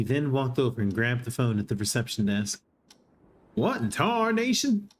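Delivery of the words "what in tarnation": high, slow hillbilly drawl; old prospector voice. Acted. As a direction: ham it up like a grizzled cowpoke. Hammed-up old-timey character exclamation.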